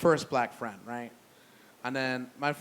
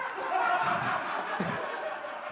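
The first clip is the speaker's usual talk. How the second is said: silent wheezing laugh. Real, not acted. Cracks up mid-story.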